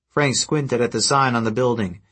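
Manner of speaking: ordinary delivery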